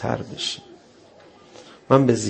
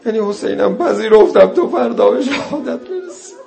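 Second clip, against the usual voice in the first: rising, pleading cry, louder as it goes; pleading lament; 9 semitones higher, 6 dB louder than usual. Retold or imitated. Zainab asks Hussein about his companions, pleading. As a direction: beg him, voice climbing in grief.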